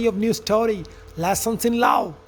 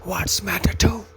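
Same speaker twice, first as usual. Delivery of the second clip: lower, measured storyteller voice; story narration; 6 semitones lower, 4 dB quieter than usual. A narrator's reading. Narrator telling friends of farmer Jonathan and son Joe, story narration. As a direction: narrate it like a fable, evenly.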